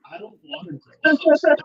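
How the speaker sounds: high, loud, slow mock wail; exaggerated high-pitched refusal; childlike protest. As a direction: wail it like a stubborn kid, drawn out. Quoting a childish whine reaction.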